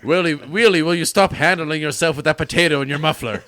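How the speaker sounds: loud, high mock scold; scolding yell. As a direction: yell at him like a fed-up parent. Scolding a car, mock parent.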